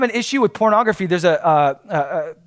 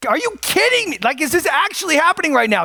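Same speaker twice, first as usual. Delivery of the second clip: retold disbelief; 9 semitones higher than usual. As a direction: pitch jumps high in mock disbelief. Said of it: "And so I'm like".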